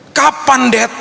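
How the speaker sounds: put-on high-pitched child's plea; questioning child. Son or daughter asking Dad about the holiday, imitated high plea. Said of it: "Tuh bertanya pertanyaan-pertanyaan seperti itu".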